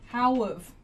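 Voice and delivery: "how of", how and have blended into one quick sound; reduced how have. Shown as a demonstration. Teacher showing the contraction. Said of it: "how and have come together".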